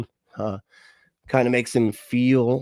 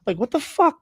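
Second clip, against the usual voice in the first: disbelieving outburst; 14 semitones higher than usual. Real, not acted. Disbelief after parody.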